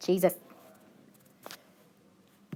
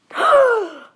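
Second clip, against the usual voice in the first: dramatic gasp; 11 semitones higher, 5 dB louder than usual. Pretend. Mock dying after Thanos reference.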